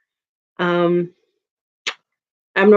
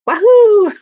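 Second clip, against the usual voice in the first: high, loud whooping cheer; Mario; 12 semitones higher, 7 dB louder than usual. Acted. Streamer recalls a Mario game, high Mario whoop. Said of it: "remember Mario three D".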